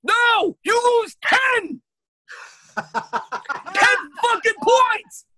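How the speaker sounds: high, loud, slow outraged shout; outraged yell. Real, not acted. Ranting to friends about the homework app, yelling in outrage. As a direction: bellow the penalty in disbelief.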